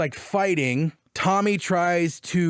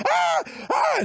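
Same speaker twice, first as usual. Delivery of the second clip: panicked screams. Retold or imitated. Ellie waking from a nightmare, panicked screaming. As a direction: scream in terror. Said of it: "she's just like".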